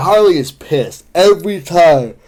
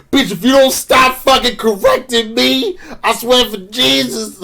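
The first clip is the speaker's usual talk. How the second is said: Jay Washington; 8 semitones higher, slower than usual. Acted. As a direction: exaggerate your co-host's indignant outburst. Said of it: "your impeccable impression of Jay Washington".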